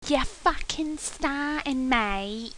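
hammed-up London chav accent; rough London slang. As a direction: play a tough London chav. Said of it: "speak like a chav".

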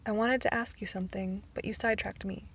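ordinary delivery, nothing marked

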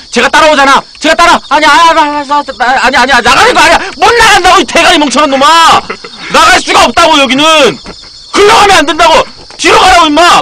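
very high, loud exasperated shouting; panicked scolding at the top of the voice; frantic yelling. Real, not acted. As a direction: scream directions at a hopeless teammate. Chased, scolds partner going wrong way.

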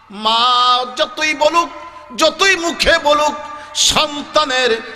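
high, intense sermon chant; full-force preaching. Acted. Preacher tells crowd of children's deaths, high impassioned chant. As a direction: cry out the story with long-held words.

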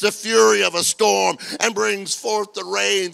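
shouted, high, drawn-out preaching cry; full-force preaching. Real, not acted. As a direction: thunder it to the whole congregation, slowly.

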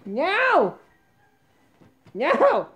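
high, wailed no; distressed cry. Real, not acted. Owner watching the cat wreck things, distressed cry. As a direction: cry out in high-pitched protest.

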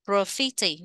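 "Graffiti" stressed on FI; second-syllable stress. Shown as a demonstration. Teacher modelling the word for learners, stress on FI in "Graffiti". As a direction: say "Graffiti" as gra-FI-ti, leaning on the middle. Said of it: "nhấn âm hai".

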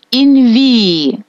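long stressed І in інвії; інвії with the weight on a drawn-out І. Shown as a demonstration. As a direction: hold the doubled І in інвії, falling at the end. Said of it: "Наголос падає на І".

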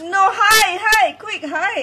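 urgent play alarm. Pretend. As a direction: hurry your friend into hiding. Urging friend to hide from ghost.

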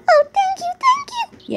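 very high, gushing thanks; grateful beggar. Acted.

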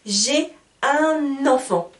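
slow un enfant linked with n, u-nenfant; liaison demo. Shown as a demonstration. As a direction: glide un into enfant with an n, slowly. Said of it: "On appelle ça en français la liaison".

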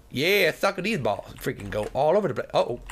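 usual speaking voice

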